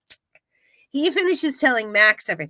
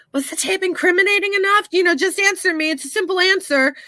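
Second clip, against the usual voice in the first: impatient pressing; 7 semitones higher, faster than usual. Retold or imitated. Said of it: "Nancy's like".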